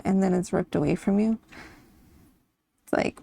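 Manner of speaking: ordinary delivery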